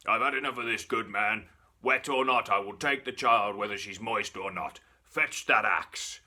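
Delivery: loud, commanding devil's voice; the devil losing patience with the baker, loud and commanding; stern devil. Acted. Devil demanding the child.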